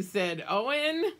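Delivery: usual speaking voice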